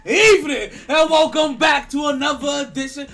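booming, high show-open announcer; announcer shout. Pretend.

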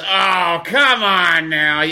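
slow "come on now" like a disapproving father; imitating her like my dad. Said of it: "She turned into my dad".

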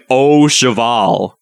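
Oh cheval, slow and drawn out, oh as the word oh; slowly mock the oh in Oh cheval; mocking pronunciation. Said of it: "this guy's over here saying".

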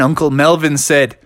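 ordinary delivery

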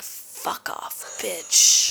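whispered fuck off; under-breath whisper. Retold or imitated. Quietly cursing the girl as she walks off. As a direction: hiss it unheard, barely voiced. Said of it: "And I'm like".

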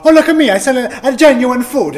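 put-on high, loud caricature Italian accent; mock yourself as a cartoon Italian. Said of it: "Is that what you want me to say?".

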